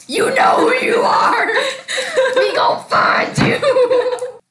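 high, loud, laughing callout; playful shout. Real, not acted. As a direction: yell it out as a playful threat. Calling out to the listener.

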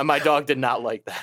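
ordinary talk, nothing marked

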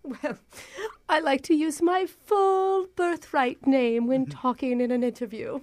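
mock-grand British speech; fake British accent. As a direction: put on a haughty British applicant, unhurried. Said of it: "I'm a little surprised by your accent".

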